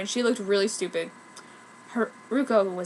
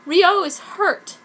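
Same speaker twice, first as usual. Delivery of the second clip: higher, louder, slow distraught line; anguished cry; 8 semitones higher, 7 dB louder, much slower than usual. Acted. Haruko telling Sakura the bad news, distraught. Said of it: "she cried".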